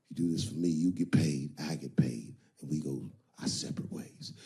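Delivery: low, smooth pimp voice. Retold or imitated. Iceberg Slim setting up the trick, low and smooth. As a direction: talk her into one last trick. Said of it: "He said".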